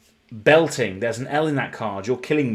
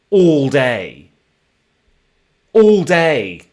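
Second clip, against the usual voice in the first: exasperated outburst; 2 semitones higher, 8 dB louder, much slower than usual. Real, not acted. Card opener groaning to chat about misreading all day, loud. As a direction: throw it out in disbelief. Exasperated at his own mistake.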